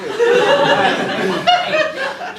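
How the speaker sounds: loud, high burst of laughter; high cackling laugh. Real, not acted. After insulting the patient next to him, high cackling laugh. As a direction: crack up hard.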